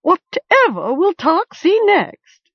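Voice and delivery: high, screechy scolding raven voice; a cantankerous bird mocking a fool; screeching raven. A narrator's reading. Raven screeching at Gom on the cliff. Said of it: "it screeched".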